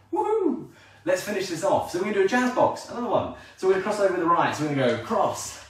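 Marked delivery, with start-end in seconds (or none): 0.1-0.7 high, gleeful whoop; excited whoop. Real, not acted. Dance teacher cheering her class on after the counts, high whoop. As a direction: let out a joyful cheer.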